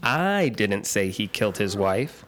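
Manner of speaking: "I" stressed, the rest said slowly. Shown as a demonstration. First in the emphasis game, stress on "I". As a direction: lean on "I", then let the line fall away. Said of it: "put the inflection on the second".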